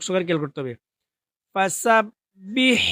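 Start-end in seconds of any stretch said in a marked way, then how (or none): 1.5-2.8 ফাসাব্বিহ বিহামদি slow, each syllable drawn out, breathy হ; correct Arabic pronunciation. Shown as a demonstration. Teacher models the right way: ফাসাব্বিহ বিহামদি drawn out. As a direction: recite ফাসাব্বিহ বিহামদি slowly and carefully. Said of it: "আবার পড়ুন, খেয়াল করুন".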